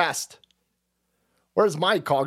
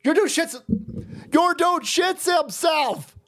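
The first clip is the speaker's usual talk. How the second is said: mock yelling; 11 semitones higher than usual. Mocking rant at Biden's staff.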